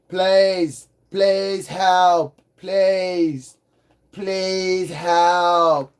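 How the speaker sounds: slow, stretched-out plea; drawn-out movie-quote plea; movie impression. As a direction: imitate a film character begging, vowels drawn out. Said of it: "It's from the movie fucking called Fifth Element".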